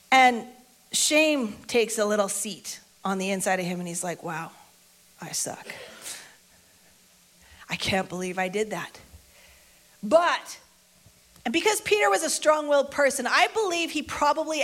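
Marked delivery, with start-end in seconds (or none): 4.2-9.0 low, deflated muttering; ashamed self-reproach. Acted. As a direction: let it drop softly, in disbelief at yourself. Peter sinking into shame.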